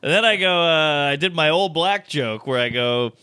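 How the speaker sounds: ordinary delivery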